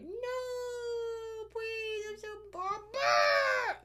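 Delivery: high whiny drawn-out plea; mock whiny voice. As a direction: whine it high and slow. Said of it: "so many mins were like".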